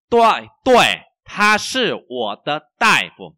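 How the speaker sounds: very slow, loud, word by word; language teacher's model reading. Shown as a demonstration. Teacher reads for learners to repeat, slow model reading.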